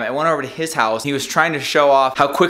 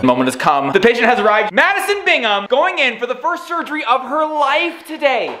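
dramatic announcer; 8 semitones higher than usual. Acted. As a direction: announce it like a big TV event. Dramatic announcer intro with music.

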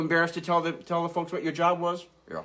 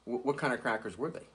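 ordinary delivery

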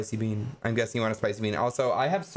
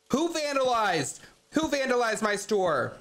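shocked; 8 semitones higher than usual. Real, not acted. Finds store vandalized in game.

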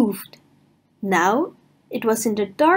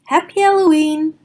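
cheerful greeting; 6 semitones higher, 4 dB louder than usual. Acted. Honey pot greets guests.

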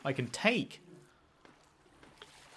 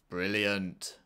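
sarcastic; 7 semitones lower than usual. Real, not acted. Sarcasm after the glitch.

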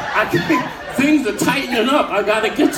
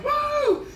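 whoop; 3 dB quieter than usual. Retold or imitated. Excited whoop.

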